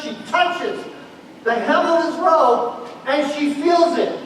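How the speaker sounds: high, loud, driving sermon delivery; full-force preaching. Acted. Preacher drives the crowd scene home, loud and urgent. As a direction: preach it hard, urging the room along.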